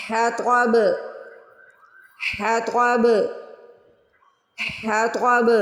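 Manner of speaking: ہا توا ب said slowly, heavy ta, bounced ba; teacher spelling out حطب; spelled-out demo. Shown as a demonstration. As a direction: sound each letter, then echo the ba. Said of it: "پہلے ہا کی آواز نکالیں".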